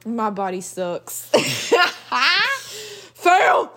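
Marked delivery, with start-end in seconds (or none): 1.3-3.1 high shouted laugh; loud laughing shout. Real, not acted. Laughing at own failure. 3.1-3.7 high loud call of defeat; fail shout. Pretend. Calling out a game verdict.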